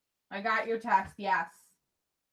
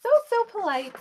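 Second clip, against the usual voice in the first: high, louder, gushing; delighted; 10 semitones higher, 5 dB louder than usual. Real, not acted. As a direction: gush over it with delight. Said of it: "Look, guys, see".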